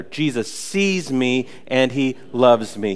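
sees stretched, slow and deliberate; slow call-and-response. Shown as a demonstration. Pastor leading the congregation, slow and deliberate. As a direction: lead the room through it slowly. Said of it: "Let's say that together".

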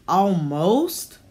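a questioning, rising "Almost?"; sceptical echo. Acted. Old landlady doubts Ashley's age with a rising "Almost?".

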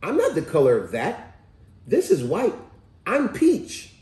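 high-pitched mock child voice; insistent kid, jumping up high at the end; little boy insisting. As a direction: imitate a kid correcting his mom. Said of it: "He said".

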